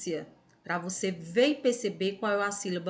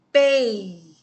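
"pei" alone, loud and held; isolated stressed syllable; 8 dB louder than usual. Shown as a demonstration. Teacher isolating the tonic syllable for pupils, "pei" loud and long. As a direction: belt out "pei" as the strong syllable. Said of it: "É a sílaba mais forte".

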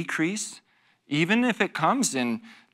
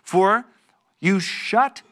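louder, declaiming the woe; scripture proclamation; 4 semitones higher, 4 dB louder than usual. Acted. Pastor reads Jesus' woe to the Pharisees, declaiming. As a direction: proclaim it like a solemn warning.

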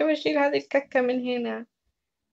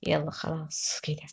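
whisper; 11 semitones lower, 6 dB quieter, slower than usual. Acted. Hushing babies to sleep.

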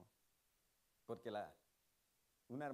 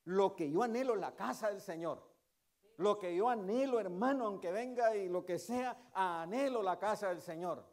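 much louder, impassioned proclamation; fervent preaching; 4 semitones higher, 11 dB louder than usual. Acted. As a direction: cry it out with all your soul. Preacher rising to climax.

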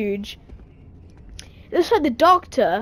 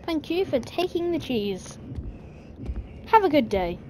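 ordinary talk

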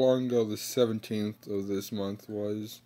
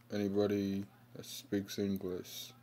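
normal talking voice

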